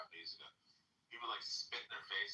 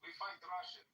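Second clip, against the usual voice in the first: high, lightly put-on eager voice; a hint of a broken-English soldier; mock Ukrainian soldier; 8 semitones higher than usual. Ukrainians accepting old weapons, in a light mock accent. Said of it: "And they're like".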